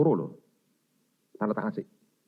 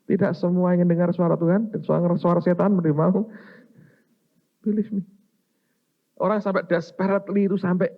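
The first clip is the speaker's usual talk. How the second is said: louder, higher preacher's challenge; preaching at full force; 7 semitones higher, 6 dB louder than usual. Acted. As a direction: press the point hard on the crowd. Preacher raising voice at congregation.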